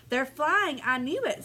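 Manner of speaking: mild yell. Acted. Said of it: "Saint Nick began yelling".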